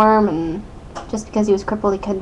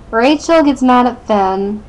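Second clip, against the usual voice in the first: usual speaking voice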